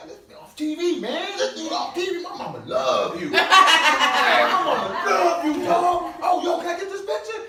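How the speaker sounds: high, loud, laughing shouts; starstruck shouting fan. Retold or imitated. Street guy yelling that his mama loves the TV star. Fan recognizing him, quoted.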